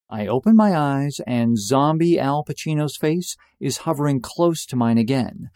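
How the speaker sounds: normal talking voice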